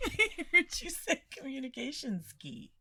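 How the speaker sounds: talking through laughter; giggling hard while speaking; laughing. Real, not acted. Cracking up at the accent.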